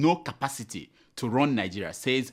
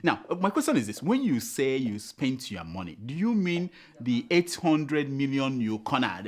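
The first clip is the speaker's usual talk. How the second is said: ordinary talk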